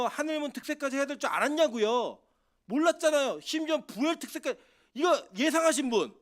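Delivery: loud, high, insistent preaching to the congregation; fervent pulpit delivery, pushing for an answer; preaching at full force. Acted. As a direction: hammer each question home at the congregation.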